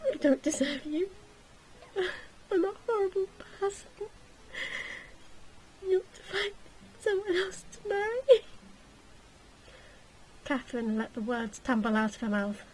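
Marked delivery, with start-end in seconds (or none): 0.1-8.4 high crying plea; tearful confession. Acted. Catherine tearfully tells Gilly to marry someone else. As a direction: sob it out, pushing him away. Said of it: "looked tearfully up at Gilly".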